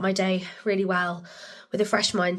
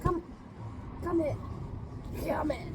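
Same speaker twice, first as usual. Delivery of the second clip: high, soft coaxing call; 6 semitones higher, 11 dB quieter than usual. Real, not acted. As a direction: coax the dog gently over. Coaxing the dog.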